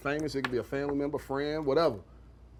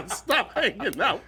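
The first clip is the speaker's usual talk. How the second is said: laughing; 4 semitones higher, slower than usual. Real, not acted. Echoing while laughing.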